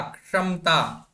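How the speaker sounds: क्षमता with half k, no full ka; slow model kshamataa. Shown as a demonstration. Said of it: "अर्ध ककार का उच्चारण".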